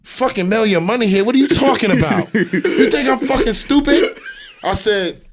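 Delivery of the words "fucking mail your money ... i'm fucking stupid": a hint of an outraged father, higher; lightly voiced indignant father; imitating angry father. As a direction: voice the dad's disbelief, rising. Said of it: "He's like".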